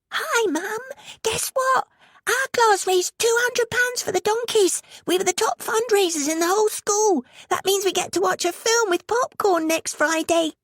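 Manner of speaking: put-on high eager kid voice; excited child. A narrator's reading. Ricky telling Mum about fundraising, excited and high. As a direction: gush the news like a proud boy.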